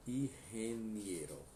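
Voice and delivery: ingeniero with a breathy H for the G, slowly, in-he-NIE-ro; slow Spanish ingeniero, H sound for G; slow teacher model word; Spanish pronunciation. Shown as a demonstration. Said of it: "o G vai ter som de R".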